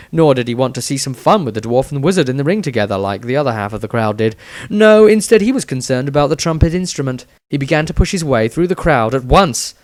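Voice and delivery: plain reading voice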